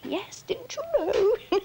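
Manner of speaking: high, drawn-out mock reply; mock chorus. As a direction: imitate everyone stating the obvious, high and stretched. Imitates everybody's reply to her.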